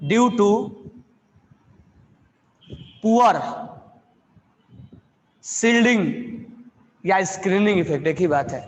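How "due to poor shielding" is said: slow dictation with long pauses between words. Real, not acted. Teacher dictating notes to students, word by word. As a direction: dictate one word at a time, slowly.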